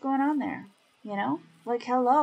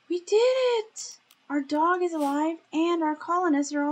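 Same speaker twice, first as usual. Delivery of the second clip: excited; 8 semitones higher, 5 dB louder than usual. Real, not acted. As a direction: cheer the win out loud. Rescue succeeded, cheering.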